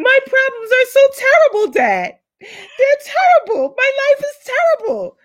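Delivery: high, whiny fake-crying wail; mock whining. Mocking her younger self complaining to dad, high fake sobs. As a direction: ham up a teenage sob story.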